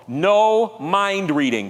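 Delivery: slow, stressed "No mind reading"; slow emphatic. Shown as a demonstration. Preacher teaching a slogan for the congregation, slow and firm. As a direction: lay each word down slowly. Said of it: "Can you say that with me".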